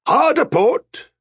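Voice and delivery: very high, loud, slow command; shouted. A narrator's reading. Captain leaning into the tiller, shouted. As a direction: bellow it to the helmsman. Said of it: "Amos shouted".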